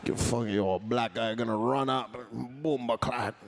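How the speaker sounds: light, slowed mock Jamaican street accent. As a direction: lightly imitate a Jamaican guy from Scarborough. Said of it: "It is a Jamaican".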